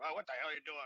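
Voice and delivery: imitating a grizzled vet griping at the salute; salty old sailor. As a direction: play a crusty old salt catching a sloppy salute. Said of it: "some salty dog out there going".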